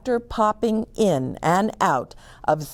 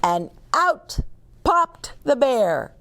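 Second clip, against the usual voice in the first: plain reading voice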